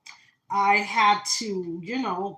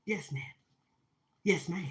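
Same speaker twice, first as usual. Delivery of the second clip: meek yes ma'am; 3 semitones lower, 7 dB quieter than usual. Retold or imitated. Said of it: "I'm like".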